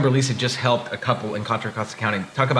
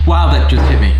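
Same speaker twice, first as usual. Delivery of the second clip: loud outburst; 2 semitones higher, 11 dB louder than usual. Real, not acted. Hot sauce heat hits him.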